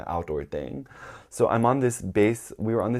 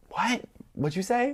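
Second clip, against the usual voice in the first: high, disbelieving what; acted-out double take, pitched way up; startled reply; 12 semitones higher than usual. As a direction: squeak it as if caught off guard. Acting startled reply to proposition.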